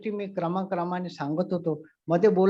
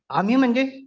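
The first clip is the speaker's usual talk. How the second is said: shocked question; 5 semitones higher, 6 dB louder than usual. Retold or imitated. Neighbour stunned by the bathtub news.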